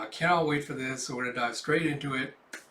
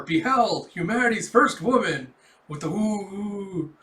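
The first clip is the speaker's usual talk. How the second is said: mock grand proclamation; 5 semitones higher, 6 dB louder than usual. Imagined divine creation of Melinda.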